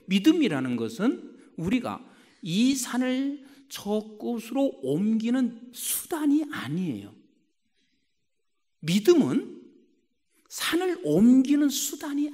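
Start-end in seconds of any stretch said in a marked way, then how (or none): none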